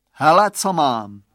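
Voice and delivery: loud, teasing show-off; boastful. A narrator's reading. Bully showing off the glasses, boastful. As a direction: brag with a touch of taunt. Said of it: "chlubí se výrostek".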